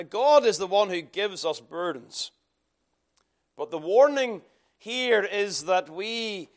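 ordinary delivery

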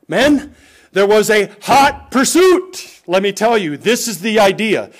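slow, loud, high preacher's cry; preaching at full force. Acted. Pastor rouses the married men, slow and loud. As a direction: swell up and call it out to the men.

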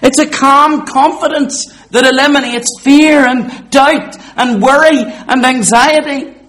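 loud, high, ringing pulpit voice; preaching at full force. Acted. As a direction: proclaim it to the back row.

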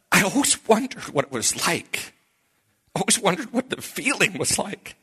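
high, overcome voice, acted; choked-up wonder, retold. New convert telling his pastor what he wondered, choked up. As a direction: imitate someone overcome with new feeling.

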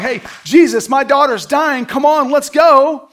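loud, high, urgent pleading; nervous, anxious. Acted. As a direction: act out an anxious father begging for haste. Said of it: "of course, is nervous and anxious".

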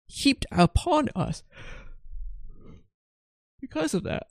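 words stretched high through a real yawn. Real, not acted. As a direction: yawn right through the end of the phrase. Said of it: "please forgive me for the yawns".

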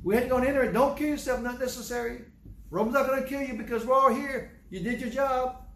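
high-pitched, insistent calling out; acted pleading, kept up high; urgent reassurance. Prisoners assuring the jailer they're all here, urgent.

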